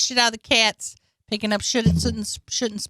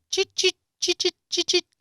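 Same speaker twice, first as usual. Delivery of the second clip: high chirpy dog noises; imitated dog noises; 11 semitones higher than usual. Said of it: "she just goes".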